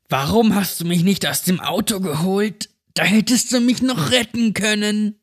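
put-on high, sharp childlike accusation; accusing possessed girl. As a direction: blame him like a possessed child. (besessenes Mädchen, anklagend)

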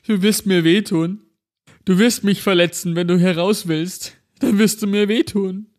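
high, tearful pleading; holding back sobs. Acted. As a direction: whimper it, terrified. Double sobbing, pleading.

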